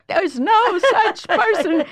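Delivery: high, loud, drawn-out protest; mock ranting. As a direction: proclaim it slowly, hammed-up for the kids. Reenacting her rant to kids.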